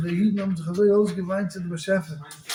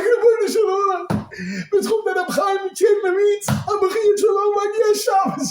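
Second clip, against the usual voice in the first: high, loud wailing plea; impassioned mock-tearful supplication; imitated crying prayer; 10 semitones higher, 3 dB louder than usual. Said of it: "He started crying".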